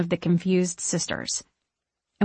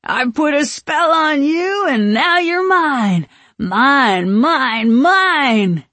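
high, loud, drawn-out wail; slow, swooping drunken yell; drunken shouting; 7 semitones higher, 7 dB louder, much slower than usual. A narrator's reading. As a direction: holler it half-sung while stumbling off. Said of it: "the stumbling one in the middle shouting".

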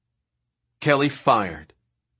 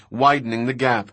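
ordinary delivery, nothing marked

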